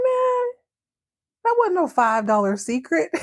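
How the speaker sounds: very high, indignant outburst; indignant squeal. Retold or imitated. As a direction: feel ripped off. Karen cheated of five dollars.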